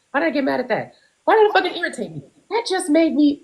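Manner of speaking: pent-up irritation in a high voice; exasperated self-questioning. Acted.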